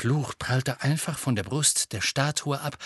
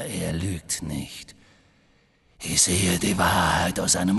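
low, slow menace, swelling at the end; cold dark lord, displeased; 4 semitones lower than usual. A narrator's reading. Dark lord rebukes his followers' failure, low menace building. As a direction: draw the words out low, then let anger break through.

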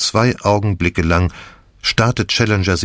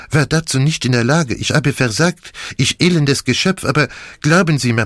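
higher-pitched, theatrically anguished character voice; Poirot remorseful; 6 semitones higher than usual. A narrator's reading. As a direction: plead earnestly for forgiveness.

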